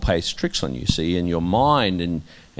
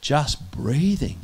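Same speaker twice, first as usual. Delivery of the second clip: slow breathing; 7 semitones higher, much slower than usual. Shown as a demonstration. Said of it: "drawn-out exhale demo".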